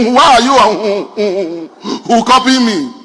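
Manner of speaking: slow, hooting stammer of indignation; angry stammer. Acted. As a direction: stammer out a cross reproach, stretching the blocks. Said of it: "My friend got angry".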